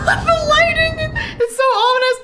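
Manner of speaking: thrilled, mock-dramatic cry; loud high squeal; excited shriek. Real, not acted. Dramatic birth moment.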